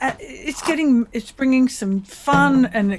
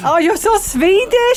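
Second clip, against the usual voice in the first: put-on high, slow singsong Swedish accent; mock Swedish accent; 8 semitones higher than usual. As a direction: tease in a hammed-up Swedish lilt. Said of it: "you can do the Swedish accent".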